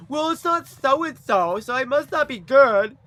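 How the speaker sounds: high, slow mocking whine; mocking whiny voice. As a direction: imitate doubters in a whiny sneer. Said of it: "they're like".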